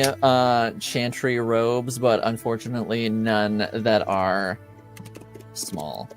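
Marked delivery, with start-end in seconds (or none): none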